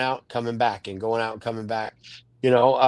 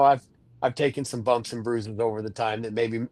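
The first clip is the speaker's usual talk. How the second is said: normal talking voice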